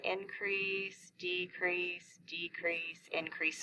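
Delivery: soft, slow murmur; hushed self-talk while tracing along; talking to oneself. Shown as a demonstration. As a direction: murmur it step by step under your breath. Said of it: "and just tell yourself".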